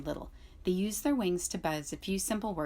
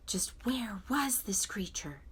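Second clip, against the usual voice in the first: hushed fox whisper; 3 semitones higher, slower than usual. A narrator's reading. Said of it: "said Fink the fox".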